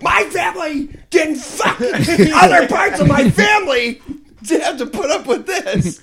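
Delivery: high snooty rant through laughter; laughing while playing a spoiled pureblood; rich kid voice. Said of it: "Sound like a rich kid".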